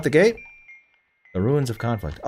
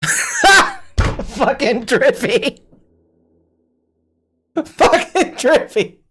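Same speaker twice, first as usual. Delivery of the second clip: words stretched very slowly through laughter, much higher; laughing; 13 semitones higher, much slower than usual. Real, not acted. Streamer laughing at what a viewer wrote. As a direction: crack up while saying the name.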